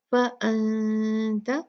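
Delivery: फ़अन्त with a slow nasal n; ghunna on n. Shown as a demonstration. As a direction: hum the n of फ़अन्त, tongue not closing. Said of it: "यहाँ पर भी हम गुन्ना करेंगे".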